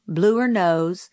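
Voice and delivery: usual speaking voice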